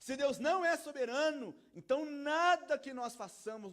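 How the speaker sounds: loud, high, emphatic preacher; impassioned pulpit proclamation; full-force preaching. Real, not acted. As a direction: preach it at full volume, ringing out.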